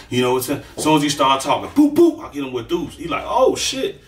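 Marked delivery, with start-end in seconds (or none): 1.7-2.2 high, loud boop boop for the punches; punch sound effects. Retold or imitated. Acting out the fight for listeners, high punch noises. 3.3-4.0 stunned oh shit. Retold or imitated. Opponent hit, shocked.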